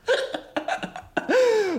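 high-pitched cackling laugh; high, breaking-up laughter. Real, not acted. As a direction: crack up at your own joke. Laughs at own put-down.

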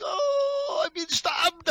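very high, whining cry of pain; mock wounded wail. Friend imitates the man stabbed in the neck, high mock wail. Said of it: "he was like".

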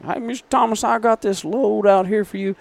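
put-on high, thick Southern accent. Supplier calling about a load, thick Southern accent. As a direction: sing it out like the Kentucky supplier. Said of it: "I was just more like".

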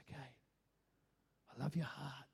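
soft, low, accepting reassurance; hushed, low, acted forgiveness; tender voice of God. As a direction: answer a confession gently and quietly. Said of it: "God goes".

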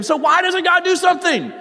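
high, loud shout; preaching at full force; frustrated cry. Acted. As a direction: demand an answer from heaven. Preacher voices David's frustration.

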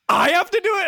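high, loud mock protest; imitating outraged girlfriend. Girlfriend protesting the cleanup, high loud imitation. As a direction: shriek it in mock disbelief. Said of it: "And she's like".

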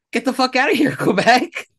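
high, loud laughing yell of the slogan; mock slogan shout. Joking about an English-Canada slogan, laughing shout. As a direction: bellow the slogan through a laugh. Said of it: "the slogan would just be".

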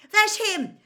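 high, loud thrilled shout; excited yell. A narrator's reading. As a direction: point out your hero. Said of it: "yelled Ron".

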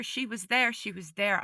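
ordinary delivery

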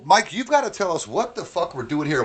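usual speaking voice

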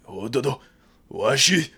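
mock Japanese words, high and loud; hammed-up fake Japanese phrase; japanese accent. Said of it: "I can say random Japanese word".

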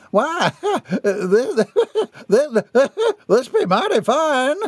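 high voice, laughing between words; delighted laughter. A narrator's reading. Jeb flabbergasted by his new fortune, laughing with delight. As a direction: chuckle helplessly as the words come out.

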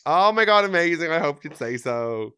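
high, loud thrilled gush; overjoyed excited burst; delighted squeal. Real, not acted. Hoped for answer, delighted.

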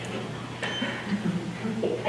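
breathy laughing hush, drawn out slow; a mentor owning up, half laughing, near silent; laughing whisper. Retold or imitated. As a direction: let it fall away into a slow laugh. Mentor admits phone fast difficult.

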